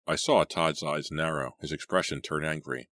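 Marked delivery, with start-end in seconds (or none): none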